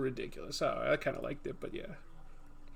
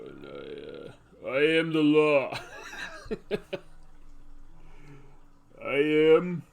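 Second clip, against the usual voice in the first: louder slowed tough-guy voice; hammed action hero, attempted deep; Rambo and Dredd impression; 2 semitones lower, 4 dB louder, slower than usual. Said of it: "Can't get my voice deep enough".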